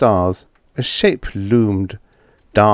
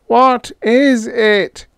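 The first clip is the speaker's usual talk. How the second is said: much higher, slow, frightened cry; shrill cry; 3 dB louder, slower than usual. A narrator's reading. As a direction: cry out in alarm. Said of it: "cried out shrilly".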